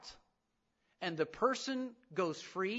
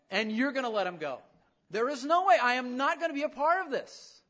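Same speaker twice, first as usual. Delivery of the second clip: loud, higher protest, rising in heat; angry Jonah; 6 semitones higher, 7 dB louder than usual. Retold or imitated. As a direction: refuse God with rising anger. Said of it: "praying to God with a heart of anger".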